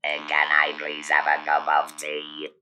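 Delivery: ordinary talk, nothing marked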